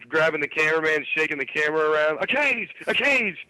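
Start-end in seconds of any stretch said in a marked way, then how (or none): 2.2-3.4 high frantic repeated shout; Ric Flair yelling. Retold or imitated. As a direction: yell it like a wrestler losing it. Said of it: "He's, like, fucking flipping out".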